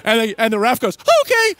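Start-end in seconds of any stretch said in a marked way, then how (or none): 1.2-1.5 very high, loud mock yelp; cowed referee squeak. Referee giving in to huge grandpa, high startled squeak. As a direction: squeak it out like an intimidated ref. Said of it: "like this".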